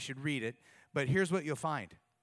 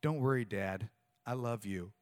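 soft and low, tenderly read; low soft reading of a daughter's text; 7 semitones lower, 3 dB quieter than usual. Retold or imitated. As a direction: read it like a loving note. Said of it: "my daughter had texted me".

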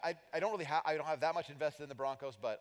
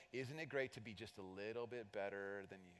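smug gloat; 5 semitones lower, 9 dB quieter than usual. Retold or imitated.

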